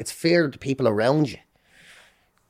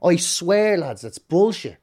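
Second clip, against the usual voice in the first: normal talking voice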